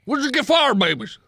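high, loud demanding dwarf; dwarf demanding answers from players, high and loud; alarmed dwarf. Acted. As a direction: fire the question in alarm.

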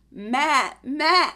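loud goat bleat. Shown as a demonstration. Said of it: "A goat says".

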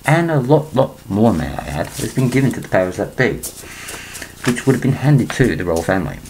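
ordinary talk

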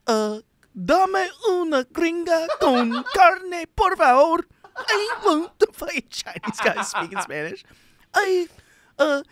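put-on high pitched, laughing accented order; Chinese-Spanish mock voice. Mocking himself ordering tacos, high and laughing. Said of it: "what the hell".

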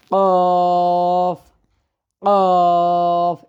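কফ hit hard from the throat; Arabic qaf. Shown as a demonstration. Teacher telling students to listen, heavy throaty qaf. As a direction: knock the throat on কফ. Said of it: "কিভাবে উচ্চারণ করবেন শুনেন".

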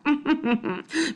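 a curt, humourless chuckle; one mirthless little laugh; short dry laugh. Acted. Missus Trance mocking Bean, a short dry laugh. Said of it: "gave a short, dry laugh".

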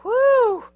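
loud, high exhaled whew; exaggerated sigh of relief; relieved whew. A narrator's reading. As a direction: let out a big drawn-out phew. Dogs relieved after escape.

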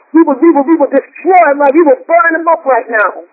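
ordinary delivery

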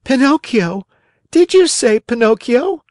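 high, excited puppet voice; startled Pinocchio. A narrator's reading. Marionette hearing his own name, high and startled. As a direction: react in high-pitched surprise. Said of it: "replied the Marionette, jumping to his feet".

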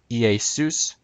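Iesus said ee-ay-SOOS; biblical Greek pronunciation. Shown as a demonstration. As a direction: say Iesus as ee-ay-SOOS, accent on the end. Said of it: "for biblical Greek".

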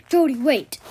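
ordinary delivery

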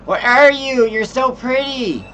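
put-on high, slow baby-talk coo. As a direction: gush over something cute. Finds a pretty creature.

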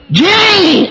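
high, stretched call of "Jane"; Grandma calling names. Retold or imitated. Grandma calling Jamie without the M, high drawn-out call. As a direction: sing out the name like Grandma calling. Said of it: "she said them wrong".

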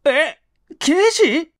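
high, slow, startled gasp of a question; stunned to learn they are police; shocked. Acted. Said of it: "びっくりして".

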